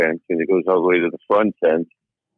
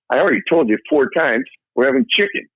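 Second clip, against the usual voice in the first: wife's voice jumping much higher, fed up; exasperated wife; 12 semitones higher than usual. Acted. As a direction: snap it back like a wife asked five times already. Deaf wife snaps back at husband.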